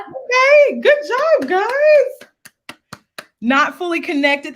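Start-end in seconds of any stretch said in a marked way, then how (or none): 0.0-2.8 high-pitched, delighted cheer; high, drawn-out congratulations; excited praise. Real, not acted. Cheering students who finished the exercise.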